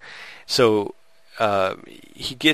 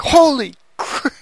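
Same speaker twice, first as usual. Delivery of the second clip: high, loud, drawn-out shocked yell; retold yell; 6 dB louder, slower than usual. Shocked by a surprise movie shooting, loud high yell. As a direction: blurt it out in stunned disbelief, slowly.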